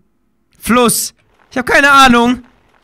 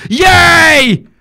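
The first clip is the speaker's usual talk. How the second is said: ordinary talk, nothing marked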